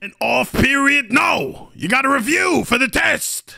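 indignant teacher shout. Acted. Teacher refusing a day off.

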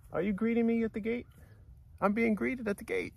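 high, soft cooing to the animals; high pet talk. Real, not acted. Man telling the llamas he's being greeted, high pet talk. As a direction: coo at the llamas.

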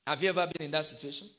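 normal talking voice

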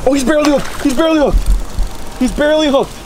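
ordinary talk, nothing marked

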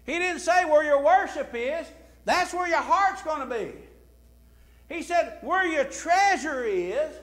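loud, high mock quote from the pulpit; fervent sermon voice; preaching at full force. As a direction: ring it out to the saints.

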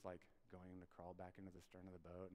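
normal talking voice

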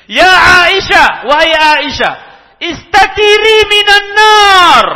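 seruan keras dan tinggi, ditarik pelan; seruan lantang. Acted. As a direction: berseru sekuat tenaga, memanjangkan tiap kata. Nabi memanggil Aisyah dalam hadis. (Nabi berseru keras kepada Aisyah, lantang dan panjang)

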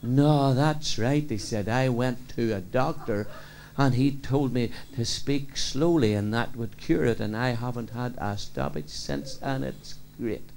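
slow, soft and drawn out; Riley speaking very slowly, softly; very slow speech. Acted. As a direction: stretch every phrase like a man told to talk slowly. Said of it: "Riley spoke very slow".

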